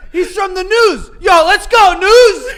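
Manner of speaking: high, very loud fan hollering; mock an overhyped friend shouting; rowdy cheer imitation, ending in laughter; imitated scream. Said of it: "And Frankie's screaming".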